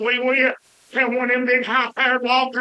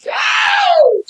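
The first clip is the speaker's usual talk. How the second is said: sudden high yelled Oh, much louder; hollered Oh; 7 dB louder than usual. Retold or imitated. Hollering at the kid to scare him, loud high yell. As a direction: let out a loud startled holler. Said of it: "and I hollered".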